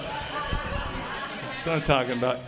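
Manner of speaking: slow, low, laughing; laughing drawl. Pretend. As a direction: stretch it out through laughter.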